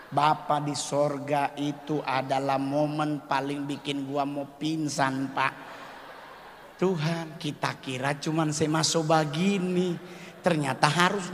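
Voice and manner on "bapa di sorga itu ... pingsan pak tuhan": ordinary delivery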